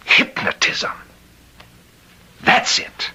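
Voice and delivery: breathy whisper as the word comes; hushed discovery; whispered realization. Acted. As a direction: whisper it as it dawns on him.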